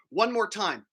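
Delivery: normal talking voice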